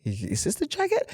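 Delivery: mocking high question. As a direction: ham up his worried question way up high. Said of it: "He's like, he's like".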